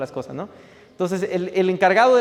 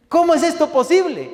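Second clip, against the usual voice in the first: high, strained, tearful protest to God; retold crying complaint; 8 semitones higher, 5 dB louder than usual. Young cook complaining to the Lord, tearful and higher. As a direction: pour out a half-sobbing lament. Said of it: "Y comencé a llorar".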